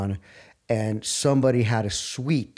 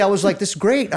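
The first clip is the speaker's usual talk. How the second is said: raised, louder delight; excited; 7 semitones higher, 4 dB louder than usual. Real, not acted. To a friend, excited and pitched up. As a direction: gush about how great it is.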